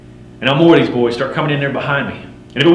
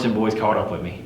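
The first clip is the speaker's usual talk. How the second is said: plain reading voice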